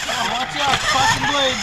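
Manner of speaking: shouted warning. Real, not acted. Warning during the race.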